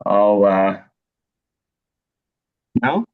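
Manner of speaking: ordinary talk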